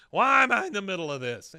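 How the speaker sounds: loud, high mock whine; hammed-up exasperated wail. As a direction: protest like a fed-up kid. Acting out Bobby's exasperated exit.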